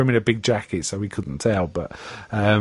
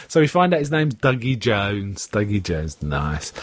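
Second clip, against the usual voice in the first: usual speaking voice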